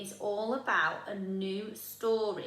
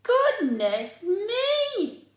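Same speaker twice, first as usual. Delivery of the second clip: much higher, louder, very slow gasp; Mum amazed; 11 semitones higher, 7 dB louder, much slower than usual. Acted. Mum marvelling at Oliver's story, high and slow. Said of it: "said Mum".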